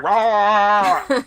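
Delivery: loud, high growling roar; Wookiee roar. Acted. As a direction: bellow like a Wookiee. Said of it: "Chewie responds with a growling noise".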